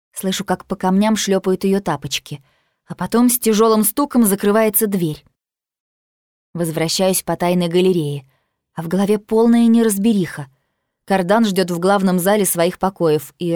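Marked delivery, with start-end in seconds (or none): none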